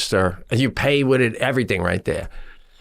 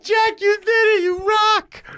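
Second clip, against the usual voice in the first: much higher gushing squeal; excited praise. Retold or imitated. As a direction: gush over her like a fan.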